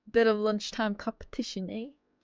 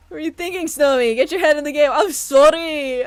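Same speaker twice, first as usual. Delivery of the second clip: self-scolding; 5 semitones higher, 11 dB louder than usual. Acted.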